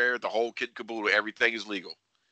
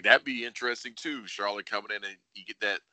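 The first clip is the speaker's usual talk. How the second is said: normal talking voice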